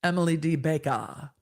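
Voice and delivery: lightly put-on Southern accent with a dropped final R; a touch of Southern, ending on "Bakah". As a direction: say it with a playful Southern lilt. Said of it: "must be read in a Southern accent".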